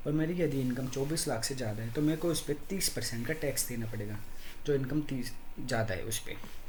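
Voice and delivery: normal talking voice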